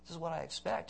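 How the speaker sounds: softly voiced resignation, imitated; quiet acceptance. Early church believer facing trials, quiet acceptance. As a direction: take it softly, as fully expected. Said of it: "it was like, you face trials".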